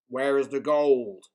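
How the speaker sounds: loud, slow pirate demand; pirate chief shouting at the troll; angry shout. A narrator's reading. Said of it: "shouted Hank Chief".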